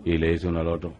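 ordinary delivery

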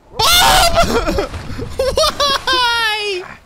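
high, loud hysterical laugh into why; despairing laugh. Real, not acted. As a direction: laugh helplessly at the fall.

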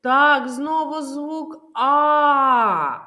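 slow, with the final "А" held long and loud; isolated vowel sound. Shown as a demonstration. Teacher answers for the children, the sound "А" loudly drawn out. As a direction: sustain the "А" at the end, big and open. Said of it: "Наступний звук у цьому слові".

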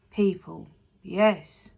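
a slow, low beast's reply; slow deep creature voice. A narrator's reading. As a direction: answer like a huge old creature. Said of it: "in its slow, deep voice".

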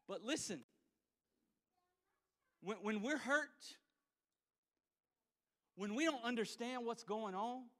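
ordinary talk

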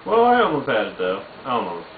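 ordinary delivery

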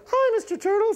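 falsetto puppet voice; high cartoon sidekick. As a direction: speak up in a tiny, very high register. Mister Goop greets the turtle.

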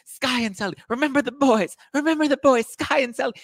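very high hammed-up cry; mock dramatic wailing. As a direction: wail like a melodramatic drunk. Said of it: "If I ever, if I ever did that".

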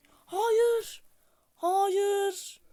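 high, loud wail of disbelief; anguished cry. Real, not acted. Gamer wails as the challenge fails, high loud cry. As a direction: cry out no in frustration.

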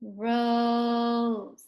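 "Rose" drawn out on a level note; slow. Shown as a demonstration. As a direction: sound out "Rose" with a long held O. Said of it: "Say it slow".

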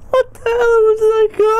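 high, loud, breathless through laughter; gasping out the words mid laughing fit; real giggling shock; laughing disbelief. Real, not acted. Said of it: "I can't even breathe".

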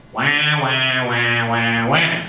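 lightly quacked wah sounds, like a duck; duck quacking imitation. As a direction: mimic a duck quacking at the teacher. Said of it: "all they heard was the quacking of a duck".

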